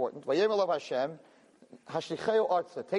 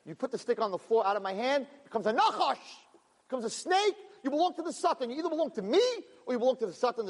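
raised, stern voice of God declaring; voicing Hashem; 8 semitones higher than usual. God warning Moshe, in a raised commanding voice. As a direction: pitch it up and lay down the law. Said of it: "said Hashem".

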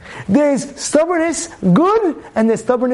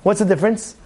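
ordinary delivery

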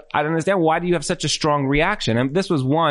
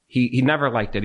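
ordinary delivery

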